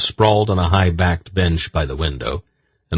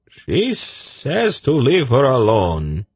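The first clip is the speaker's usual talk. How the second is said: high, slow, careful voice; lightly voiced young eunuch; 8 semitones higher, slower than usual. A narrator's reading. Tzadi telling of the snake, high slow voice.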